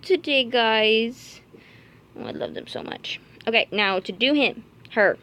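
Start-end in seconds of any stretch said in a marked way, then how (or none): 2.2-3.2 breathy whispered gush. Real, not acted. Whispering to viewers about her baby bunnies, whispered adoration. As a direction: whisper it with love.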